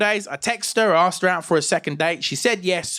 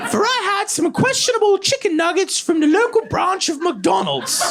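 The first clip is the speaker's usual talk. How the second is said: put-on much higher, prim, posh-mannered drunk teen; over-formal gentleman trying to sound sober; Regency-era gentleman. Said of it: "I spoke like a Regency-era gentleman".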